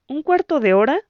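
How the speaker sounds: high, rising, unsure echo of cuarto; hesitant echo. A narrator's reading. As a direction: question the wait, unsure. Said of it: "preguntó indecisa". (eco dudoso y agudo de "cuarto")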